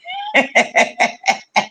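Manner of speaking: a sudden, very high burst of real laughter. Real, not acted. Recalling a fun night of partying with viewers, real high laugh.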